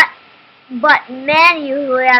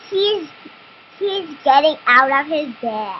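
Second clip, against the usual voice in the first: ordinary delivery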